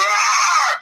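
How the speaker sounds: sudden hammed-up beast roar; monster roar. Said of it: "he's a monster".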